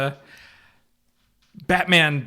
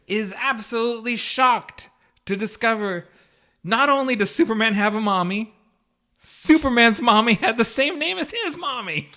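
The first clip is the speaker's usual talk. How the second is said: mock shock; 6 semitones higher, 4 dB louder than usual. Mocking Batman's shock at the name.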